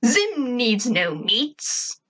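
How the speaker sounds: slow, drawn-out cartoon proclamation; swooping put-on voice, very slow; Zim. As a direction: refuse grandly, stretching every word. Zim's haughty character voice.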